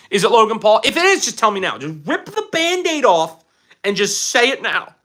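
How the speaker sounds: high, urgent mock freak-out; mock panic. Mock freak-out, then "just kidding.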